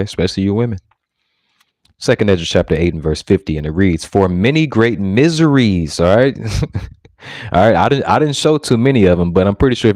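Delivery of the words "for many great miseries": slow, weighty King James reading; measured preacher's scripture reading; solemn scripture reading. Acted. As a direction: read the verse like holy writ. Reading scripture aloud in sermon.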